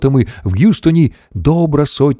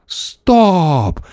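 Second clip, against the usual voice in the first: high, drawn-out halt; a long mild yell; 9 semitones higher than usual. A narrator's reading. Mission Control cuts the astronaut's description short, yelling. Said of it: "зарепетував центр керування польотами".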